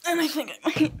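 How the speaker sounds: high, loud, tearful outburst; close to crying. Real, not acted. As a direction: blurt it out on the verge of tears. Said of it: "I literally wanna cry right now".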